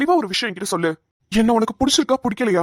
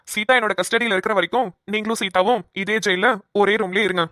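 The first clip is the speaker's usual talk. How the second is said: usual speaking voice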